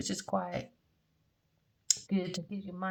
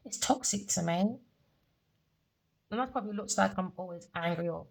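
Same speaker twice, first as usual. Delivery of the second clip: ordinary talk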